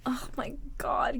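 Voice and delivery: breathless whisper of dismay; soft aghast whisper. Real, not acted. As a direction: whisper it in disbelief. Whispered reaction.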